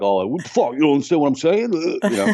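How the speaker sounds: Rocky Balboa. Retold or imitated. Upset student to baffled classmates, as Rocky Balboa. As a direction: slur it like an upset movie boxer. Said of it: "I turn into, like, uh, Rocky Balboa".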